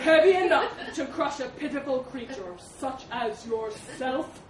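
loud, scornful elephant; menacing elephant. Acted. Elephant threatening to crush the mouse, loud menace. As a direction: loom over a pitiful creature.

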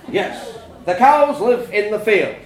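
loud, slow, emphatic answer; exasperated dad. A narrator's reading. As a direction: drive it home loudly.

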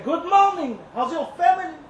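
high, loud sing-song hello; chirpy hammed-up good morning; mock cheerful greeting. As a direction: put on a bright fake politeness.

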